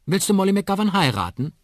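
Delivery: put-on high, loud, nosy child voice; little girl. Trisha quizzes her uncle, high child voice. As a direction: ask as a curious small child.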